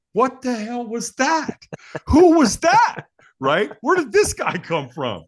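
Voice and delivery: high, drawn-out astonished questions; bewildered disbelief. Retold or imitated. Reacting to a vanished stranger, bewildered. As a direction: pitch way up, punching each question.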